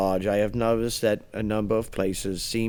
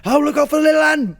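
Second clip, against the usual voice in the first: movie sidekick cry; 14 semitones higher, 5 dB louder, faster than usual. Retold or imitated. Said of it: "he's that one guy".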